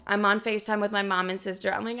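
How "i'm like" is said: ordinary talk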